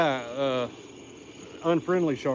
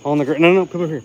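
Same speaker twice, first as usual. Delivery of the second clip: ordinary talk, nothing marked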